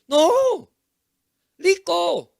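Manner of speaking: high, emphatic "no", then "rico" said "liko"; acted frustrated father, mispronouncing "rico" with an L; exasperated father correcting. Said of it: "Papá dice".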